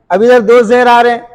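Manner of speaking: ordinary delivery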